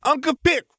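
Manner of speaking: put-on high, loud child's call; a small boy calling for attention; toddler calling out. A narrator's reading. Toddler calls for his uncle.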